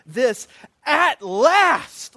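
loud, high, drawn-out exultation; flood of emotion. Acted. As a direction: stretch it out in overwhelmed relief. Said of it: "you can just imagine the flood of emotion".

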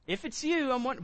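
high, tentative acted plea; tentative wondering. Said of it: "And so it's not like".

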